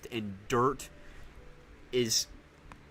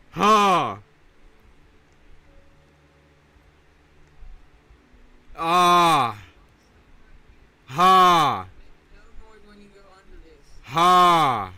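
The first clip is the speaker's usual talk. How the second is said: loud, higher ha yells; game yell; 6 semitones higher, 11 dB louder, much slower than usual. Real, not acted. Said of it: "I have to mute my mic when I do that".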